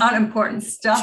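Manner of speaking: high, sing-song mommy voice; bright and sweet, as if to a toddler; talking to a child. Acted. Mom introduces her daughter to the podcast, high sing-song.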